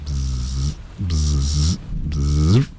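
buzzing bee imitation. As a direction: buzz like a bee at the window. Imitation for guests.

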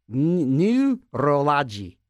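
neurology stretched out very slowly after a false start; slow careful try. Shown as a demonstration. DJ testing the word again, slow careful try. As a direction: stumble on the N, then drag out neurology. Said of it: "Is that really neurology?".

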